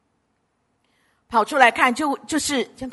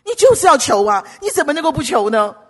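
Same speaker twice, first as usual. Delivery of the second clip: loud, higher, insistent exhortation; emphatic urging; 4 semitones higher, 6 dB louder than usual. Real, not acted. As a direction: push them hard to act. Preacher urging congregation to ask. (大声力劝)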